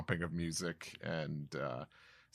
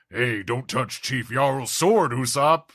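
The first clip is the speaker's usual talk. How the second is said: loud, higher scolding giant; angry giant; 5 semitones higher, 11 dB louder than usual. Acted. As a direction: warn him off sharply. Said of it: "He says".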